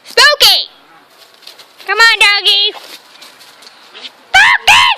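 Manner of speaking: loud, high shouts urging the dog on; calling the dog. Real, not acted. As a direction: yell run as if he's far away.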